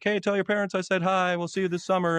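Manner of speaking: imitating a player's parting jab; mock friendly taunt. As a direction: tease your rival with fake sweetness. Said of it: "they'll be like".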